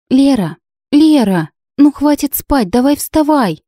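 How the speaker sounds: put-on high, bouncy little girl calling; a small girl calling her sister awake; child's voice. A narrator's reading. Little girl bouncing on the bed, high child's voice.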